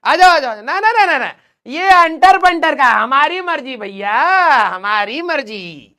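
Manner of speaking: loud, high-pitched playful protest, vowels drawn out; mock outburst. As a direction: shout down a heckler in fun, stretching the words. Mock outburst at heckling students. (बनावटी ज़ोर से, खींच-खींचकर चिल्लाना)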